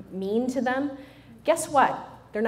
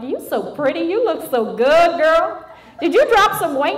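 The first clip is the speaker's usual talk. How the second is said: high, loud, gushing flattery; gushing over-the-top compliments; 8 semitones higher, 9 dB louder than usual. Retold or imitated. Hugging a rude woman, loud gushing praise. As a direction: smother her in fake sweetness.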